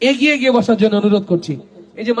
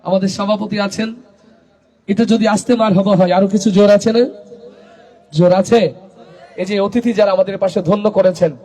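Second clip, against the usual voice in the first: ordinary talk, nothing marked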